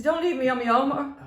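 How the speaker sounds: high, loud mock pleading; retold panicked plea. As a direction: imitate a man begging not to be abandoned. Retold plea from frightened companion.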